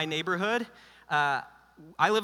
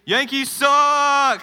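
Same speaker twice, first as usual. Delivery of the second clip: drawn-out stadium yell, much higher and louder; mock fan shout; 9 semitones higher, 10 dB louder, much slower than usual. Pastor playing a Red Sox fan in a drawn-out stadium shout. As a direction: bellow it like a Fenway bleacher fan. Boston crowd chant punchline.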